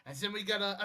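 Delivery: ordinary talk, nothing marked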